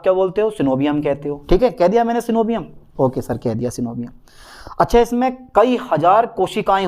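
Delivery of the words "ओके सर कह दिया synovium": low, soft student acknowledgement; student's meek reply. Acted. Student answering sir about synovium, low soft acknowledgement.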